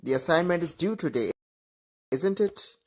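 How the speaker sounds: quick statement, then isn't it rising clearly upward; worried check, isn't it rising; rising tag. Shown as a demonstration. Student who forgot deadline, rising tag. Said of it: "checking because you are uncertain".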